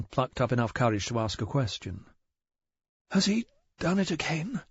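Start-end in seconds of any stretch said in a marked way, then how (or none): none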